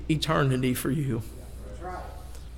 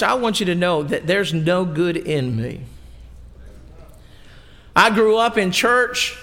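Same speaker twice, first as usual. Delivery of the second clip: ordinary delivery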